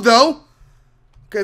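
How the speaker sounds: ordinary talk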